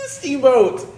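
high, loud tearful squeal; mock crying. Co-host naming his star of the night, mock crying. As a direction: squeal it out in fake tears.

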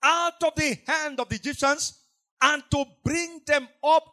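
full-force preacher proclaiming loudly; sermon delivery rising to a loud climax; preaching. Acted. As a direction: thunder it from the pulpit. Preaching scripture to congregation.

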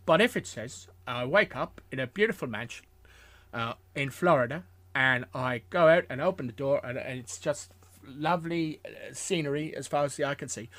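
put-on voice of a famous film actor; celebrity impression, halting as it goes; Michael Caine impression. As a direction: tell it as the actor's own anecdote, in his voice. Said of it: "should I do the voice?".

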